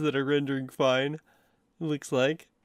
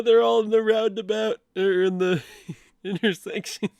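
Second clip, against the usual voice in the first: laughing; 7 semitones higher, 4 dB louder than usual. Real, not acted. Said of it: "from laughing this much".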